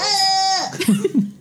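high, loud bleating baa; sheep bleat. Pretend. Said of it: "That was my sheep impression".

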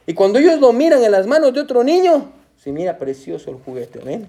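normal talking voice

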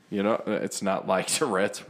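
ordinary delivery

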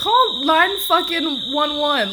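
urgent outburst at a character; yelling at the screen. Real, not acted. Viewer shouting at a show character, slow loud outburst.

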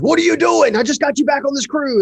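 father's furious high-pitched yell; angry dad. Retold or imitated. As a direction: yell at your son, pitch flying way up. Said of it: "My dad's walking up to me, like, angry".

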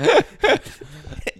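high-pitched burst of laughter; loud high laugh. Real, not acted. Friend cracking up at the sketch, high loud laugh.

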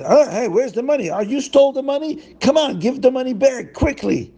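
high, demanding accusation; hammed-up townsfolk demanding the money; accusing crowd. Crowd demands stolen money from Zusha, mock angry.

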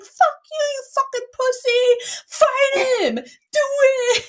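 very high, hammed-up screech; Jerry Springer girl screaming. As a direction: shriek it as if being hauled away. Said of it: "I was like Jerry Springer girl".

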